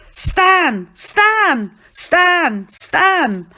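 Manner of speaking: high, loud repeated calls; calling out. A narrator's reading. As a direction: shout the name over a noisy crowd. Calling their boy across a crowd.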